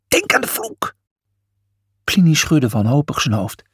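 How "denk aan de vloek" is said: very high fearful warning; dire warning. A narrator's reading. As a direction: spook them with it. Cornelius warns.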